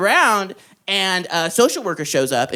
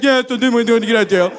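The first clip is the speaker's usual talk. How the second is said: fast, mock deaf-accented speech; put-on deaf voice; 4 dB louder, faster than usual. Faker to the social worker in jail, put-on deaf voice. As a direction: imitate a deaf speaker, rushing through it. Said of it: "talking to the social worker in the deaf voice".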